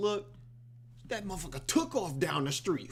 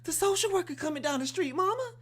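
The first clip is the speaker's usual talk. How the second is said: high, loud frantic warning; panicked daughter; 13 semitones higher, 5 dB louder than usual. Retold or imitated. Daughter warning mama the social worker is coming, high and frantic. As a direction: shriek it up to mama.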